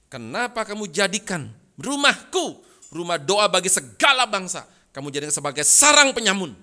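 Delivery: loud, rising outrage at the temple traders; Jesus's furious rebuke. Acted. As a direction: thunder it out as an enraged Jesus. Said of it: "Maka Yesus marah luar biasa".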